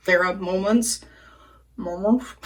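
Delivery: slurred "moments", as if through marbles; mumbled words. Real, not acted. Vlogger fumbling the title of her story, mumbled. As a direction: trip over "moments", blurring the sounds together. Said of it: "Take the marbles out of your mouth".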